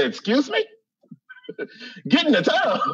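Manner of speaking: can't believe what he just heard; disbelieving. Retold or imitated. Comic retelling his reaction to the friend on the phone, laughing disbelief.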